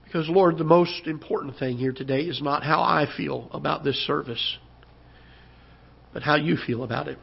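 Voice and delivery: ordinary delivery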